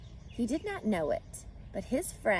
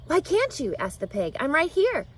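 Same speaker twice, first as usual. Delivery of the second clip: higher, puzzled pig; eager pig; 7 semitones higher, 5 dB louder than usual. Acted. Wilbur puzzled that Charlotte can't see him, higher. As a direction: ask innocently.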